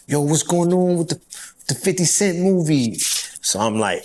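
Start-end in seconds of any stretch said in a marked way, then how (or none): 0.1-3.0 put-on higher New York accent, leaning on the yo. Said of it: "I had to use my New York accent".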